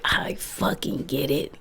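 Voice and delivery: hushed, breathy aside; whisper. Retold or imitated. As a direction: whisper it like a confession. Whispered aside about people pleasers.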